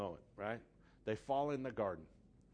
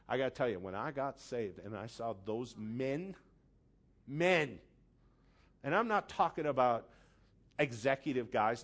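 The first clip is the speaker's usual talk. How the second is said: ordinary delivery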